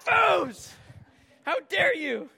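high, loud, indignant holler; outraged yell. Retold or imitated. Embarrassed man yelling at best friend, indignant shout. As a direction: bellow it up high in mock outrage. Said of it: "I'm yelling at my best friend".

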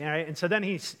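normal talking voice

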